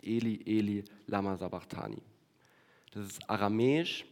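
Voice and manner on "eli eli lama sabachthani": normal talking voice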